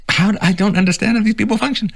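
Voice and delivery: incredulous high-pitched complaint; baffled. Real, not acted. Incredulous outburst.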